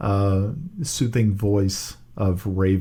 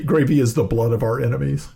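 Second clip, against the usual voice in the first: ordinary delivery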